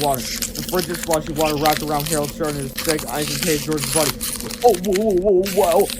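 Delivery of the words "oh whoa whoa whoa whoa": louder, rising panicked whoas. Acted. Boys being frozen in ice, crying out in panic. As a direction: cry out as the ice closes in.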